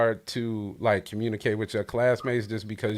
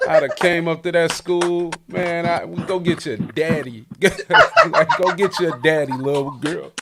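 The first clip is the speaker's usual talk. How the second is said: loud, high protective outrage, half joking; hammed-up defending the kid from bullies; mock outrage; 5 semitones higher, 7 dB louder than usual. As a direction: stick up for her like a big brother. Host mock outrage over bullying.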